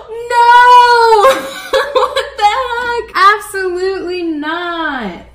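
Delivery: high, very loud wail breaking into laughter; dramatic horrified shout. Real, not acted. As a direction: scream in over-the-top disbelief. Painting leaks, mock catastrophe.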